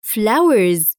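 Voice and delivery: flowers ending in a buzzing z sound. Shown as a demonstration. Teacher shows the plural ending to students, flowers with z. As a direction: end flowers with a clear z. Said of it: "ينطق مثل حرف ال Z".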